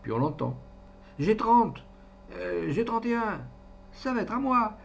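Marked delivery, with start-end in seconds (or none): 1.2-4.8 eager claim. A narrator's reading. Passenger claims his turn.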